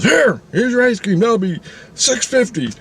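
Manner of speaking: high-pitched acted salesman; acted-out high ice cream man; brusque vendor. Vendor hands over cone, demands money.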